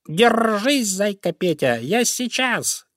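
high hurried call; urgent call. Acted. Young crow calls to the bunny, high and urgent. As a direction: reassure the bunny on the way. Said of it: "крикнул он".

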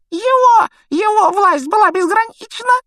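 very high, loud snivel; Pettigrew snivelling. A narrator's reading. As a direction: wail it in a squeaky tearful voice. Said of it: "хлюпнул Петтигрю". (громкое тонкое хныканье)

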